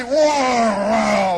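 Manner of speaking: loud, drawn-out "whoa"; imitated roar. Storyteller as police suddenly surround the truck, loud roaring whoa. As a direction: burst out with a sudden long roar.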